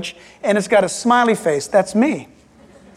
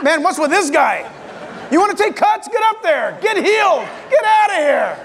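higher, impatient pushy voice; annoyed man in line; 5 semitones higher than usual. Acted. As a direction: shove the demon guy to the front.

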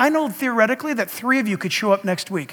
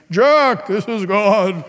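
louder, booming voice from above; mock voice of God; 4 dB louder, much slower than usual. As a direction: announce it like God speaking. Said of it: "I've never heard God say".

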